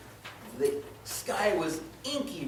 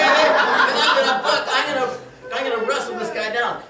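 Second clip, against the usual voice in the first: excited shouting; 8 semitones higher, 13 dB louder than usual. Acted. Wrestling the scorpion.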